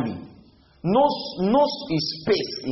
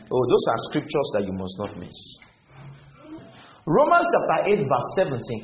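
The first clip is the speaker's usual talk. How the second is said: normal talking voice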